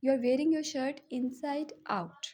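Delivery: slow, word by word, for learners to repeat; careful dictation pace; slow teaching model. Shown as a demonstration. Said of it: "ठीक है?". (धीमा, साफ़ उच्चारण)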